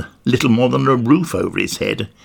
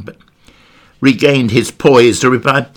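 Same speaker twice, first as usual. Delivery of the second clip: ordinary delivery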